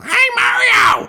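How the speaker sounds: loud, very high drawn-out screech; Toad. Shown as a demonstration. Demonstrating the traditional greeting to the hero, loud falsetto screech. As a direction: squawk a greeting in shrieking falsetto. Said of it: "I'm not gonna do the Toad voice, the traditional".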